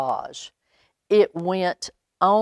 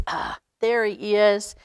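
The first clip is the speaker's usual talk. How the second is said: ordinary delivery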